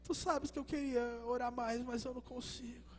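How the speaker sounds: slow, soft and broken, near sobbing; Peter in tears. Acted. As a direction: let the words fall apart in tears. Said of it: "começa a chorar". (Pedro chorando, devagar e baixinho)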